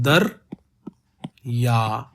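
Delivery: दर या said as two drawn beats, दर then या; split syllables. Shown as a demonstration. Teacher splits दरिया into दर and या to show the count. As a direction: break दरिया into दर and या. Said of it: "ऐसे बोला जा रहा है".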